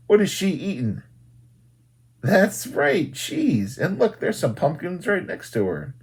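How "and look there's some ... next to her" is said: ordinary delivery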